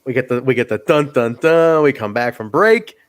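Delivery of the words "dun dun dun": dramatic dun-dun-dun, last note louder; imitated cliffhanger music; sung suspense sting. Recapper cutting to the break, vocal suspense sting.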